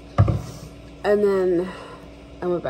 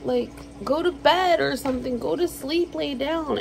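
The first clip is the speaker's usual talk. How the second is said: higher voice telling the cat to sleep; higher pet-talk, go to sleep; coaxing cat; 7 semitones higher than usual. Retold or imitated. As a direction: talk to the cat pitched high. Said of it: "I'm like".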